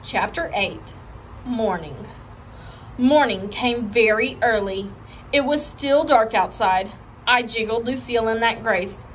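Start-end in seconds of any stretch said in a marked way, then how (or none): none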